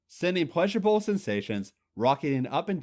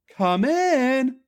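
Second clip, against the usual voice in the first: high, loud call to the door; 12 semitones higher, 7 dB louder than usual. A narrator's reading. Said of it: "Trish called out".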